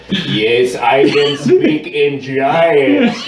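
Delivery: loud, slow, rising giant voice; booming giant voice. Acted. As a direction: call it out big and slow. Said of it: "raises his voice and also speaks in Giant".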